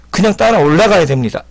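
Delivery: ordinary delivery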